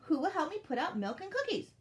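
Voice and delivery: high, loud, cheery request; smiling. A narrator's reading. Elf asking friends to help with cookies, smiling. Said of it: "said with a smile".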